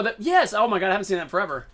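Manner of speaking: ordinary talk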